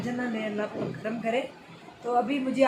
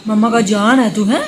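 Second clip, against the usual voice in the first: mother hushing her crying son late at night, loud and pleading; 13 dB louder than usual. Real, not acted. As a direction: soothe and coax him out of tears, raised over the crying.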